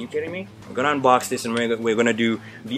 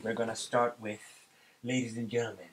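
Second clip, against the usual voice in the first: ordinary talk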